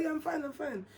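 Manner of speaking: high, soft insistence of being fine; feigned calm. Retold or imitated. Pregnant girl reassuring her suspicious best friend, feigned calm. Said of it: "tried to look calm".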